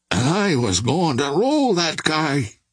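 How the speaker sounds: muttered in helpless confusion; bewildered mutter. A narrator's reading. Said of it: "he muttered in helpless bewilderment".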